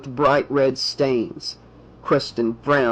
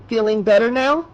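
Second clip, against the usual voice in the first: high, lilting caring question; kindly school nurse; 8 semitones higher, 4 dB louder than usual. Acted. School nurse to waking student, high solicitous voice. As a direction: check on a patient in a raised, sweet voice.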